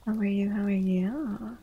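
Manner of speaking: ordinary talk, nothing marked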